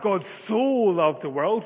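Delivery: "so" stretched long and loud; stress on so. Shown as a demonstration. Preacher repeats how we tend to read it, emphasizing "so". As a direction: hit "so" hard and draw it out. Repeats the exaggerated common reading.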